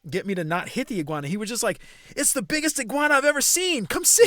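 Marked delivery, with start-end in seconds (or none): 2.1-4.3 very high, loud, building excitement; wildly excited imitation. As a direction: mimic a thrilled man summoning onlookers. Acts out man raving about iguana.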